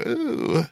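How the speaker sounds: high-pitched playful ooh; mock "ooh". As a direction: coo it high, in mock surprise. Retold drunk reaction to awkward moment.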